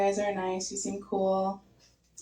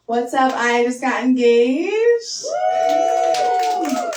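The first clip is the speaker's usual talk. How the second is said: loud sing-song, slow, squealing whoop; coworker's cheering announcement; 5 semitones higher, 9 dB louder, much slower than usual. Retold or imitated. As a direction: belt it out like a thrilled coworker. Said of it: "impression of my coworker".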